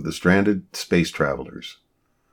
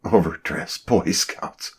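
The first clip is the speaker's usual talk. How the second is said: amused; slower than usual. A narrator's reading. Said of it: "She shook her head and chuckled".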